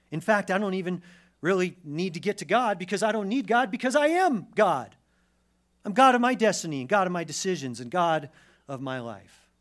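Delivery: loud, high, swaggering declaration; mock arrogant boasting. Preacher voicing someone who needs no God, mock arrogant boast. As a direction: build it into a self-deifying proclamation. Voices one who claims godhood.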